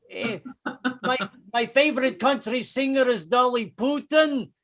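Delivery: comic Russian posing as a Southerner; fake Southern accent, Russian underneath. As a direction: insist you're American in a heavy Russian accent. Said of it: "they would try to fake one".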